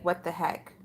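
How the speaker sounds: hushed, stunned aside; quiet what the heck. Retold or imitated. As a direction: say it low to yourself in disbelief. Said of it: "I'm like".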